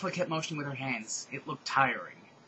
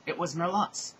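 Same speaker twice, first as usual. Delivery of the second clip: ordinary delivery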